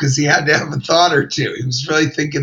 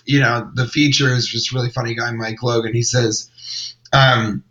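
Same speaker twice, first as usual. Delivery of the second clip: ordinary delivery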